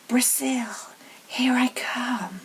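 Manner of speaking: whispered snake voice, slow on the last words; hissing snake whisper. Acted. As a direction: hiss it out like a snake. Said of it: "a low hissing voice said".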